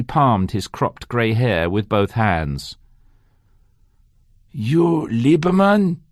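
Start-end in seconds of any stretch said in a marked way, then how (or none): 4.5-6.0 slow, German-tinged question; a slight German accent, slow. Acted. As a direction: lean into a German accent, drawn out. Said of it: "asked it again, more American, less German".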